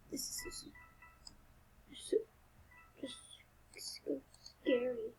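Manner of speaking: slow, soft, frightened whisper; very slow hushed dread; scared whisper. Real, not acted. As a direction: whisper your fear, slowly and shakily. Hiding, scared of the murderer.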